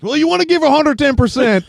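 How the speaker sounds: high, loud jock interview voice; sportsy athlete interview. Retold or imitated. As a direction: play a dim jock at the post-game mic. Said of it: "gives the most sportsy, like, interview ever".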